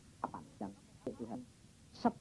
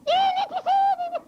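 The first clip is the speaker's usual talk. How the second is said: much higher, loud wife's voice; wife crying out that the demon is here, high and loud; frightened wife; 7 dB louder than usual. Retold or imitated.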